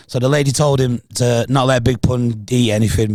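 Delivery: ordinary delivery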